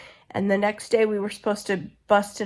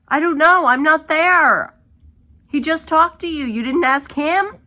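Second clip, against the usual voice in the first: loud, fed-up, higher-pitched rant; exasperated outburst; 7 semitones higher, 8 dB louder than usual. Real, not acted. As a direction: snap back in real frustration. Venting at rude U-Haul call.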